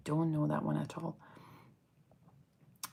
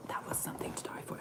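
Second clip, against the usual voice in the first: hushed, fast, near-silent gush; whispered rave about a favourite scent; breathless whisper; 11 dB quieter, faster than usual. Real, not acted. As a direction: whisper it like a treasured secret. Gushing over a loved perfume.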